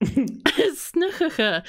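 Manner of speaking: "snigurka" slow and high through a laugh; drawn-out, laughing "snigurka"; giggling attempt. Real, not acted. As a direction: try to get "snigurka" out while giggling. Giggling attempt, then restates it.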